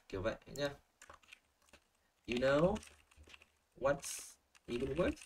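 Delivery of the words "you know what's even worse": slow, broken into "You know" and "what's even worse", each rising; slow model phrase. Shown as a demonstration. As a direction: pause after "know", stretch "even worse". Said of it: "nếu mà có được cái độ linking như này". (đọc mẫu chậm, ngắt sau "know")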